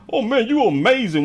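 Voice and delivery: lightly mocking fake praise. Mocking a manager praising a worker, hammed-up gushing. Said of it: "You don't be like".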